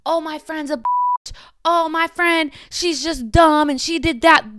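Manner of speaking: put-on high, slow mean-girl bitching about a friend; mock catty gossip. Shown as a demonstration. Demonstrating talking crap about a friend, catty and mocking. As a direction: sneer it like petty trash talk. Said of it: "I'm giving an example".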